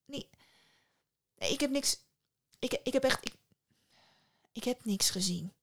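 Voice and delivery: broken restarts with long pauses; flustered stutter, giving up at the end; stammering. A narrator's reading. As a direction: stumble toward a reluctant admission. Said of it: "stotterde Psyche".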